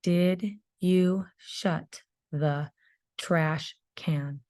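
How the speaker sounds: each word drawn out very slowly, x0.5; teacher dictating a sentence to first graders; slow dictation. Shown as a demonstration. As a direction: dictate it slowly so kids can write. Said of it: "All right, so".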